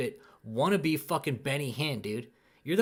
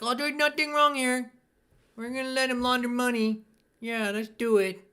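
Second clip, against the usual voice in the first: high, loud, falsely cheerful mock official; mocking the IRS; 10 semitones higher, 5 dB louder than usual. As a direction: play the IRS as a clueless, happy stooge. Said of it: "I R S is like".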